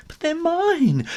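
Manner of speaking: high, slow squeaky protest; a small kitten clinging on; pleading kitten. Acted.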